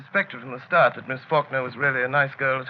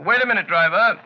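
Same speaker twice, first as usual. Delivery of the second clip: louder call to the driver; 5 semitones higher, 6 dB louder than usual. Acted. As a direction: raise the voice to stop the driver. Calling to the cab driver.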